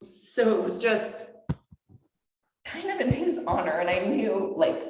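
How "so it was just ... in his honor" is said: fighting back tears on her dog's memory; choked up. Real, not acted. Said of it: "I'm getting choked up".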